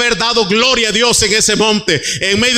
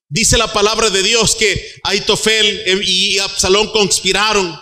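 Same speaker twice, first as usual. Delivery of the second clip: usual speaking voice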